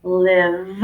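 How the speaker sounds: slow, drawn-out sounding out; teacher sounding out words slowly. Shown as a demonstration. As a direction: stretch each sound as you write. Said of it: "trying to sound out my sounds".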